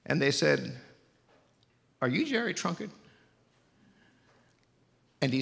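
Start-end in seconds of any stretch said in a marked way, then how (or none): 2.0-2.9 pitched up high, curious question on the phone; surprised operator. Retold or imitated. Operator asks the grown man if he is Jerry, high and curious. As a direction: ask it brightly like an operator.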